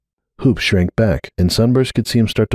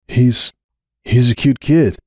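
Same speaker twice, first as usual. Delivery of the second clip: unsteady voice; 4 semitones higher, slower than usual. Acted. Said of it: "his voice unsteady as his smile had been".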